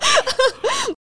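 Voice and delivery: high burst of real laughing; real laughter. Real, not acted. Host laughing at the three-foot snot detail, high giggle. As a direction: crack up at the absurd detail. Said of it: "也太长了".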